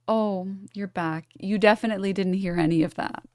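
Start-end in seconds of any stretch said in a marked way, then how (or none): none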